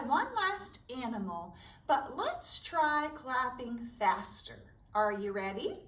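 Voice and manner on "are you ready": ordinary delivery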